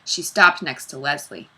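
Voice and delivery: plain reading voice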